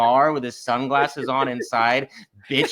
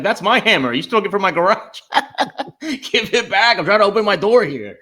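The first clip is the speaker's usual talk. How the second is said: mocking Bill Maher; 7 semitones higher, 6 dB louder than usual. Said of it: "And he's like".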